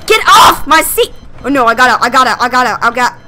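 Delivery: ordinary delivery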